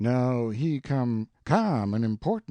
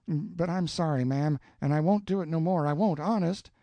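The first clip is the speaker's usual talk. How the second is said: put-on higher, pleading apologetic boy; distressed; 7 semitones higher than usual. A narrator's reading. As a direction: fumble out excuses sheepishly. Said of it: "looked distressed".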